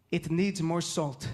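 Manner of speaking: put-on slow, low Middle Eastern-accented dad; deadpan verdict in a Middle Eastern accent. Dad judges his first communion, slow Middle Eastern accent. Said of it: "my dad has an accent".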